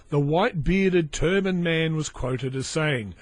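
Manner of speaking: ordinary talk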